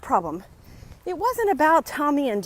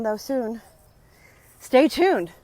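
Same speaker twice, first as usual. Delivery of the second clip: usual speaking voice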